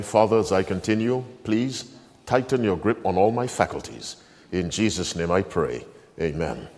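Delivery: hushed prayer before reading on. Real, not acted. Pastor prays aloud.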